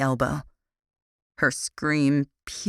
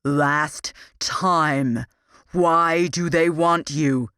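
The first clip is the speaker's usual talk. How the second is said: loud, slow final demand; 4 dB louder, much slower than usual. A narrator's reading. Captor giving his prisoner one last chance, loud demand. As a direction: bear down on the question, last warning. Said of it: "he demanded".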